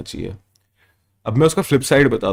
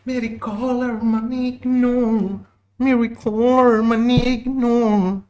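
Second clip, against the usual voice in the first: much higher, slow sing-song Hindi; mock whiny caller; 13 semitones higher, slower than usual. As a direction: stretch it out in a high mocking voice. Reading a donation message mockingly. (ऊँची, धीमी, चिढ़ाने वाली आवाज़)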